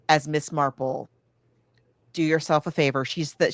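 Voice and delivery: usual speaking voice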